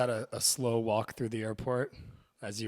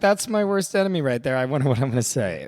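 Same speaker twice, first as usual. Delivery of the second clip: Elizabeth Taylor; 3 semitones higher, 10 dB louder than usual. Pretend. Co-host playing the star spotting her enemy, loud mock alarm. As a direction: blurt it fast as a hammed-up star. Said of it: "She's like".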